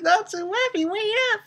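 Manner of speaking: whiny Lucy. Retold or imitated. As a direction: whine after him like a sulky anime girl. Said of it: "Lucy's like".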